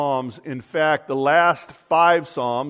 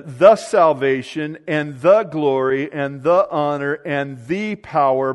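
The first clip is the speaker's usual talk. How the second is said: every "the" punched before its noun, louder; stress on each THE; 4 dB louder than usual. Shown as a demonstration. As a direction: hammer each "the" as the one and only. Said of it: "Each of these is definitive".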